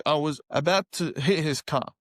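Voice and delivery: usual speaking voice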